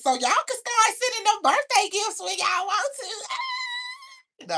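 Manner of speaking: high, drawn-out laughing plea; giddy high-pitched hint; playful squeal. Real, not acted. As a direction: squeal it out, stretching the last word. Jokingly asking viewers for birthday gifts.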